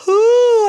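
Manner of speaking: loud, high yawning ooh; a real yawn breaking out mid-class; big yawn. Real, not acted. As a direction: let a huge yawn escape. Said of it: "I don't know why I'm yawning".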